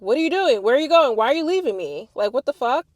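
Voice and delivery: upset shouting at the partner who walked off; retold confrontation. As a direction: demand answers from the boyfriend. Confronting boyfriend who left.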